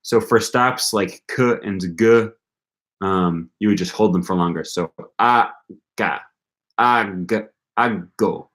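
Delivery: normal talking voice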